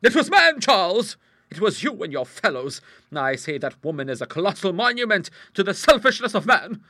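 forceful rhetorical declaiming; indignant tirade. Acted. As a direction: lecture the nephew with passion. Hostess continues tirade at nephew.

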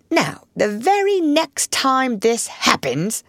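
gruff and suspicious. A narrator's reading. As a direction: challenge the callers with gruff suspicion. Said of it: "said a gruff and suspicious voice".

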